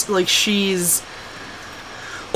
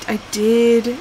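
normal talking voice

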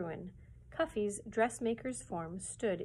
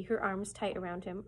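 plain reading voice

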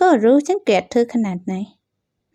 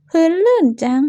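fake cry of slipping; 6 semitones higher than usual. Fakes slipping to break vase.

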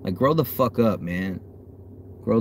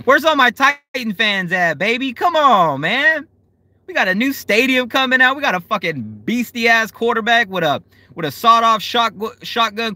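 high, loud hype for his team; rally the Titans fans at full pitch; fired-up fan bragging on the quarterback; hyped-up fan; 9 semitones higher, 3 dB louder than usual. Real, not acted. Hyping up his team to chat.

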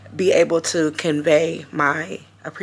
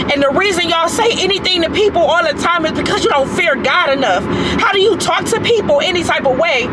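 loud, high, real heated pleading; impassioned rebuke; 7 semitones higher, 4 dB louder than usual. Real, not acted. Rebuking commenters who don't fear God, impassioned and angry. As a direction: admonish them hard, voice pitched high.

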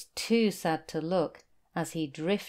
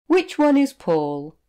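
higher and louder eager question; curious whale; 8 semitones higher, 8 dB louder than usual. Acted. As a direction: ask eagerly, looking around the island. Whale asks on island.

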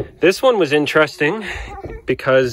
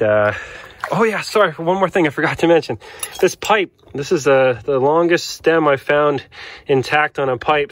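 ordinary talk, nothing marked

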